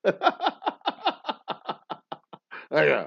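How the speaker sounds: a loud, laughing [name] thank-you; quick hammed-up [name] thanks through laughter. Old [name] thanking people, laughing mock impression. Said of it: "old [name]".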